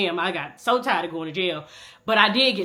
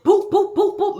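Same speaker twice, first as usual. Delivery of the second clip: punching sound effects; 11 semitones higher, 5 dB louder, slower than usual. Retold or imitated.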